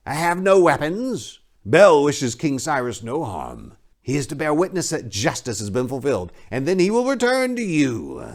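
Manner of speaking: high, oily priest voice; a leering, smug high priest; sly high priest. Acted. High priest placating the king's men, high and oily.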